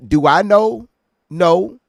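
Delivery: punchy, high and loud; emphatic rhetorical questioning. Real, not acted. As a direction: ask it loudly and answer yourself just as hard. Emphatic rhetorical questions, answering self.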